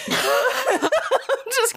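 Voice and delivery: laughing helplessly while talking; can barely talk through laughter. Real, not acted. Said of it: "and just laugh".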